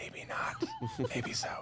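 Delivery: teasing whispered aside; whispering. Real, not acted. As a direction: whisper with a giggle. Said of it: "Why are we whispering?".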